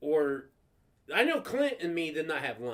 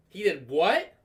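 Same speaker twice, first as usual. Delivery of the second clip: raised-volume question, rising at the end; first disbelief, voice lifting on WHAT; shocked mom; 5 dB louder, slower than usual. Retold or imitated. Mom hearing the school's call, startled question.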